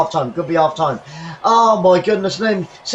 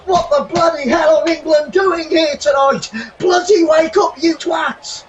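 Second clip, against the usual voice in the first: angry rant; 5 semitones higher than usual. Real, not acted. Fan shouting at England to wake up, angry. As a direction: yell at the team in frustration.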